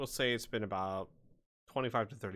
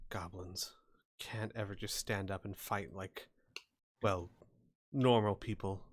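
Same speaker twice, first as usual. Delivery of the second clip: grumbling; 5 semitones lower than usual. Acted. Claire grumbles about goblins as she comes over, grumbling. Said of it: "just kinda grumbling".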